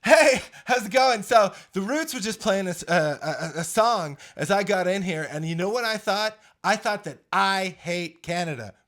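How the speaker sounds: bubbly late-night monologue breaking into laughs; Jimmy Fallon impression. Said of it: "Jimmy Fallon".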